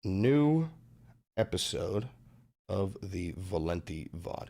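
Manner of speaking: drawn-out deep intro announcer, slow and soft. Acted. Streamer announcing his new vod episode, lightly dramatic announcer. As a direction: announce the show title like a trailer.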